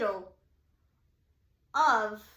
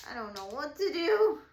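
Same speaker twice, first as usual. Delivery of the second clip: high, louder helpless wail; retold freaking out; 4 semitones higher, 6 dB louder than usual. Stranded driver with no idea what to do, retold freaking out. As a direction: act out panic with a stretched ending. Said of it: "freaking out".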